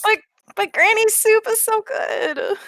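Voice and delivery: put-on high, slow kid whine; whining. Sick child defending Granny's soup, whining. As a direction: protest like a whiny child. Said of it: "he's sort of, like, whining".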